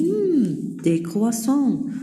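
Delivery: high, slow mmm then des croissants; slow, high, delighted mock child; savoring boy's voice. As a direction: relish the croissants like a hungry boy. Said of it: "il dit".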